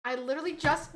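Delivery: normal talking voice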